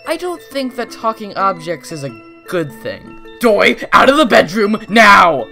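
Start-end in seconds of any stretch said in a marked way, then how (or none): 3.4-5.5 loud commanding call; urgent shout. Acted. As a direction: order him out at once.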